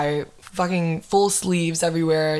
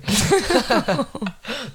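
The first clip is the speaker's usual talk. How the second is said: amused burst of laughter; real laugh; 4 semitones higher than usual. Real, not acted. Host cracking up over the duty-free joke, real laughter.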